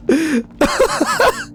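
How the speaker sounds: high cackling laugh; laughter. Real, not acted. Gamer cracks up at Pizza Tower, high laughter. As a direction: laugh helplessly, pitched high.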